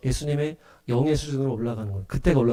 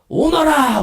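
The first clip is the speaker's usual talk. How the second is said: high, loud drawn-out summons; summoning shout; 11 semitones higher, 6 dB louder, much slower than usual. Acted. Living creature of Revelation summons a horse, booming high shout. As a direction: cry out like a heavenly creature summoning. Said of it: "네 생물이랑".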